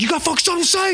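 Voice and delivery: very high-pitched mock yell; retold angry yelling. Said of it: "like, yelling".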